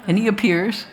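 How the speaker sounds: usual speaking voice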